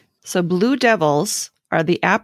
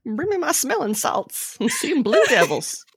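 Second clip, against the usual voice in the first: mock Southern belle swooning, high; a fainting Southern lady, lightly put on; hammed-up Southern belle; 8 semitones higher than usual. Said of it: "the Southern is getting worse".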